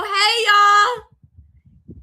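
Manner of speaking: high, loud drawn-out hey; cheery greeting. Retold or imitated. Teen bursting into the dance room with a cheery hello. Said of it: "I go".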